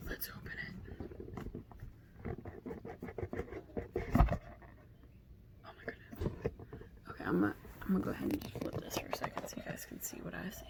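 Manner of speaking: hushed excited whisper. Real, not acted. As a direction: whisper it as you unwrap. Whispering while unboxing the deck.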